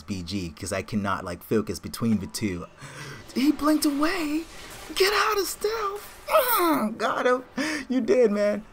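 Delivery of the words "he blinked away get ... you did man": high, loud squealed call-outs; real gamer shouting at the kill; excited game yell. Real, not acted. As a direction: cry out in high-pitched battle excitement. Shouting in heat of game fight.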